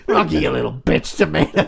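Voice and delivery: loud mock American trainer accent; put-on American accent. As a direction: hurl the insult in a hammed-up American accent. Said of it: "sounds better in that accent".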